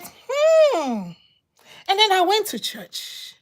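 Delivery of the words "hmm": high, drawn-out questioning hmm; a suspicious hum, pitched way up; musing hmm of growing doubt; doubtful hmm. Retold or imitated. Quoted reaction while reading scripture.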